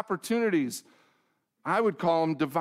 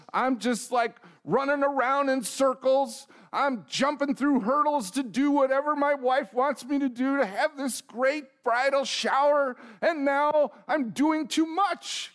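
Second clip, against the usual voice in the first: rising excitement; 9 semitones higher than usual. Real, not acted. Sermon builds to a shout.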